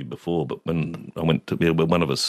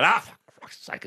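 higher, exasperated outburst; irritated father; 13 semitones higher than usual. Retold or imitated. Father hearing the toots, irritated. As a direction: imitate a dad losing patience. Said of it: "my father got irritated with it".

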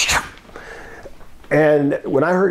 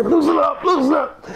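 much higher, frantic begging; panicked pleading; 13 semitones higher than usual. Retold or imitated. Coco in a face lock begging the boss, high and frantic. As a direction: beg him to loosen the grip. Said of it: "I hear".

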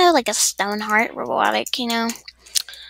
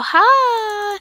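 excited; 10 semitones higher, 5 dB louder than usual. Real, not acted. Greeting a new character excitedly.

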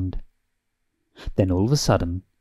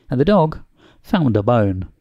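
ordinary delivery

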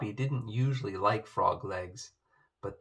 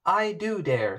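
defiant; 8 semitones higher, 6 dB louder than usual. Acted. Poppy defiant.

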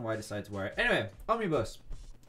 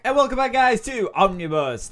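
upbeat intro; 7 semitones higher, 7 dB louder than usual. Real, not acted. Channel intro greeting.

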